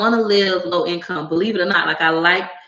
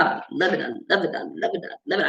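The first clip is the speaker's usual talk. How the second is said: softer, trailing repetition; hushed relish; 7 dB quieter, faster than usual. Real, not acted. Creator swears she loves being ratchet, hushed relish. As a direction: repeat it under the voice, savoring it.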